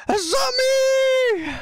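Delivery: huge, high, drawn-out cry of the name; heroic shout. Acted. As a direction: belt it like a hero's entrance.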